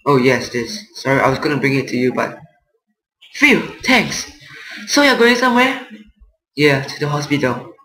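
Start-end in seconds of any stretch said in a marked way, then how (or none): 3.3-5.9 higher-pitched game character voice; voiced Paul. Neighbor asking a departing man where he's headed, higher character voice. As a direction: put on a higher voice for the neighbor's lines. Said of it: "Paul, what is it?".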